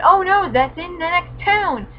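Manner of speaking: sarcastic fake alarm; mock dismay. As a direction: ham up the tragedy. Mock dramatic despair.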